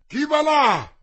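high, loud sentry's challenge; shouted challenge. A narrator's reading. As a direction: cry it out sharply as a challenge. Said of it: "gridò una voce rauca".

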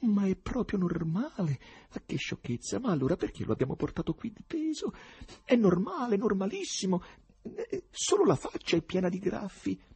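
high-pitched, alarmed inner monologue; frightened thought. A narrator's reading. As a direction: think it through in alarm, voice held high. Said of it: "pensò spaventato Ryuhin". (pensiero spaventato, tono acuto)

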